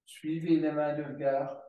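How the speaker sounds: normal talking voice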